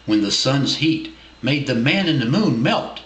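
ordinary delivery, nothing marked

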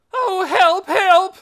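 high, loud cry for help; piercing wail. A narrator's reading. Minnie raising the alarm over the escape, piercing wail. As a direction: wail the alarm at full pitch. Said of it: "let out a piercing wail".

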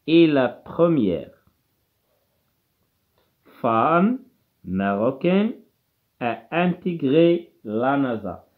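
deliberate classroom dictation pace; slow dictation. Shown as a demonstration. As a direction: read the sentence very slowly with long pauses. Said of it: "dictating a sentence slowly".

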